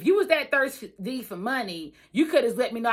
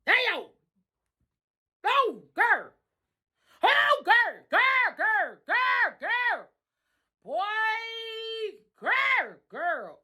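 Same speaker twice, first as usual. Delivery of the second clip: exasperated cries; 10 semitones higher, much slower than usual. Real, not acted. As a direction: cry out in overwhelmed disbelief. Reacting in disbelief, crying out.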